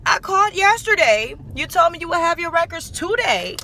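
replaying telling them off; retold complaint. Her retold complaint to salon.

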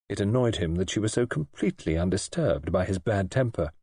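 ordinary delivery, nothing marked

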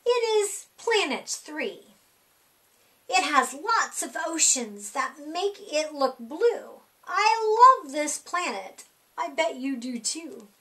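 ordinary delivery, nothing marked

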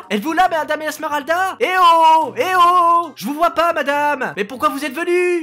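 loud, high calling out for the teacher. Acted. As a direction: shout to find her. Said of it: "t'es malade de crier comme ça".

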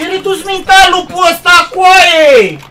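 loud, high furious yell; angry shout. Real, not acted. Gamer yelling at a useless teammate. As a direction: shout the insult at him.

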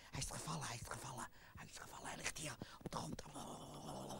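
unvoiced whisper off camera. Real, not acted. Presenter searching for the fallen puppet, whispering. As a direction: whisper it so the audience won't hear.

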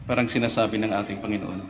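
ordinary talk

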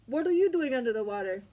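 quick, light eel voice asking. Acted. Eel asking the diver, quick and curious. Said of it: "The eel's like".

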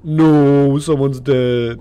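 loud, slow shocked cry; shocked outcry. Real, not acted. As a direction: blurt it out in real shock. Discovering a dead body in game.

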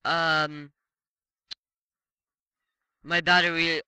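ordinary delivery

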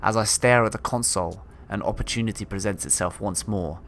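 calm reading of a log; story narration. Acted. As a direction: read it like a captain's journal. Reads game log aloud.

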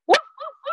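very high yappy woofs; dog woof. Pretend. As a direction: yap like a little dog.

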